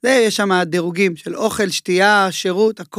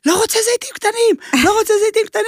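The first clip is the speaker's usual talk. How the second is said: much higher, mock furious chef outburst; imitating chef screaming; 13 semitones higher, faster than usual. Head chef yelling at a cook in the kitchen, mock furious high outburst. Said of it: "השף צורח עליו".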